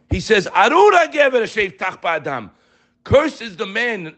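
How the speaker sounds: high-pitched, insistent sermon delivery; fervent preaching. Real, not acted. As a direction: preach it high, driving each phrase home. Preaches against self-reliance.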